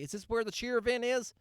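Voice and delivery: mock a clueless mom asking the way; mocking a clueless parent, high and bright; mocking idiot voice. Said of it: "what some idiot would want to say".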